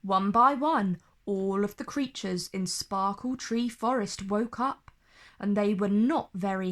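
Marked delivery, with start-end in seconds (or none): none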